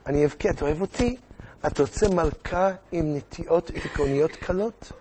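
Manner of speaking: soft, sing-song mock pleading; pleading queen. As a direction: wheedle the king with threats of tears. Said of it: "היא תגיד כדלהלן". (מלכה מתחננת, בכיינות מוצגת)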